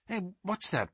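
ordinary delivery, nothing marked

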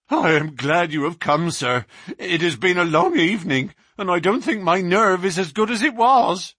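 a jumpy policeman relieved help has come; shaken young constable. Acted.

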